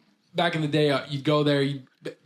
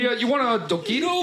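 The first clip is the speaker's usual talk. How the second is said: put-on friendly Canadian accent, pitched up; 8 semitones higher than usual. Canadian food truck guy offers a taquito, friendly accent. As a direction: offer it like a chatty Canadian vendor. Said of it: "a very strong Canadian accent".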